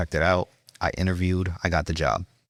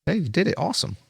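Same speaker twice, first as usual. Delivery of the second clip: high-pitched, pleased reaction, lightly acted; happily surprised boss; 12 semitones higher than usual. Boss to cook after the window is filled, happy. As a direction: imitate a boss pleasantly won over. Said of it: "he was happy".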